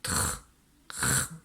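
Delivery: "tr" with the R rasped at the back of the throat, no vowel; throat-scraped R. Shown as a demonstration. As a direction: softly scrape out the R of "tr". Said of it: "Ça racle au fond de la gorge". (« tr » raclé au fond de la gorge)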